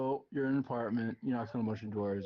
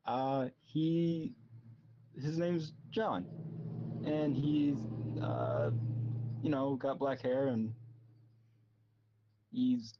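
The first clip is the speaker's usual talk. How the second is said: high, halting voice, slow and unsure; hesitant old lady; 5 semitones higher, slower than usual. Acted. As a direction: stammer out the lie as an old woman. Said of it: "You are stuttering and something that begins with hesitating".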